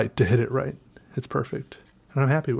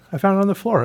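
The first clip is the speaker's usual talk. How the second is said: much higher, gleeful; more excited; 8 semitones higher, faster than usual. Real, not acted. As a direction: say it again, beaming. Repeats it, pitched even higher.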